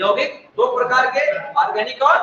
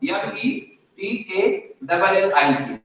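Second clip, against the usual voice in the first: slow spelling; 3 dB quieter, slower than usual. Shown as a demonstration. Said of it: "इसको English में कहा जाता है मेटालिक".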